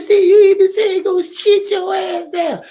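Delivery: put-on very high, slow, crying child voice; high whiny child. Little boy whining back at his mama, high and teary. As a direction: whine it like a boy caught out.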